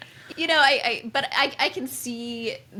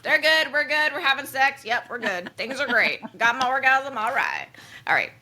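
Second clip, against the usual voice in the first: put-on playful Southern accent, higher and louder; 5 semitones higher, 5 dB louder than usual. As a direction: slip into a hammed-up Southern twang. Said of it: "slipped into a Southern accent there".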